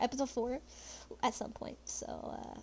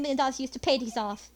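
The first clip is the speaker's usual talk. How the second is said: normal talking voice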